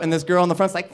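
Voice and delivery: ordinary talk, nothing marked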